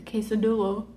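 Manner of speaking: quesadilla butchered as "kay-suh-DOO-luh"; mock botched "quesadilla" with a "doo-luh" ending; mispronounced. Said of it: "something ridiculous".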